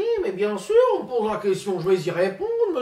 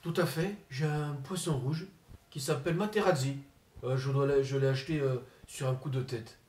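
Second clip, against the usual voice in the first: Zinedine Zidane impression; 2 semitones lower, 7 dB quieter than usual. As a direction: mumble like a shy, sulky footballer. Comedian impersonates Zinedine Zidane.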